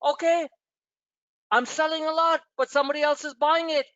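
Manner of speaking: high mock seller's voice, a bit fast; imitating a seller. Host acting out traders reading the doji, light mock voice. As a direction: voice the chart's seller thinking aloud. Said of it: "that's like".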